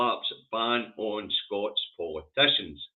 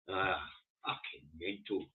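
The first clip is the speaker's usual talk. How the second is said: ordinary talk, nothing marked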